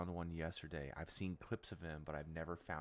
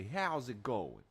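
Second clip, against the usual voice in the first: slow, loud, higher-pitched Brooklyn greeting; Brooklyn accent; 5 semitones higher, 5 dB louder, slower than usual. Acted. Gamer showing how the goblins say hello, exaggerated Brooklyn accent. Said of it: "They're all from, like, Brooklyn".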